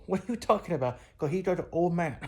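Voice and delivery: ordinary talk, nothing marked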